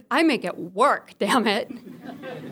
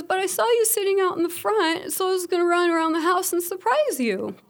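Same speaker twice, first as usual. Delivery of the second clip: put-on light, high child's voice, eager and innocent; little boy's voice; 9 semitones higher than usual. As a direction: imitate her son explaining his surprise plan. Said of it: "And he goes".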